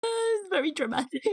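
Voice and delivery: high-pitched giggling while speaking; laughing. Real, not acted. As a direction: say it through real laughter. Amused by a dramatic voice.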